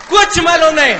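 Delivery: shouted, high-pitched admission of cluelessness; emphatic exclamation. Retold or imitated. Telling audience he knew nothing, shouted emphatically. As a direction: blurt it out at full volume. Punchline on total ignorance.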